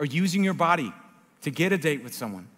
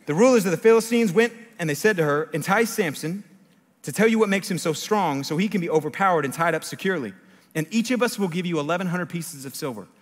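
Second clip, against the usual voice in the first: higher, projected public reading; scripture reading; 4 semitones higher, 5 dB louder than usual. A narrator's reading. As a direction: read the verse out with force. Reads the Bible aloud.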